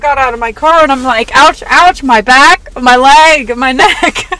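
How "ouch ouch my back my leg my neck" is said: loud, hammed-up ouching about every sore spot; mock aches and pains. Telling her co-host about climbing out of the car, loud mock ouches. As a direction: groan out each sore body part, half laughing.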